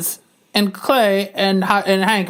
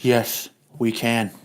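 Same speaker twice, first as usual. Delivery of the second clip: low, soft put-on wife voice; a weary wife handling everything; 10 semitones lower, 5 dB quieter, slower than usual. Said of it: "wife reply".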